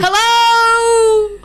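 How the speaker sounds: high, loud sing-song hello; a loud, high greeting; calling out hello. Acted. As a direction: call out brightly across the room. Marley calls out to Banfor.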